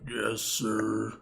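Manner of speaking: slow-witted servant drawing out the words; dutiful, drawn-out reply; obedient henchman. Acted. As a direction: answer as a lumbering henchman.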